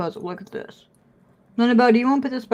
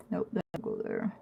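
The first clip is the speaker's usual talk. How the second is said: whisper; 2 semitones lower, 14 dB quieter than usual. Real, not acted. Hushed aside to dog.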